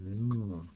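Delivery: drawn-out ng from the back of the mouth; held ng sound. Shown as a demonstration. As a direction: hold ng like the end of "sing". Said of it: "back of the tongue presses against the roof".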